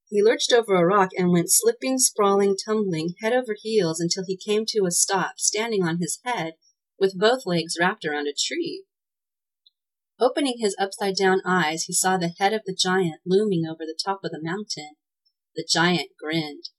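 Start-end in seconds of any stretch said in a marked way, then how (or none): none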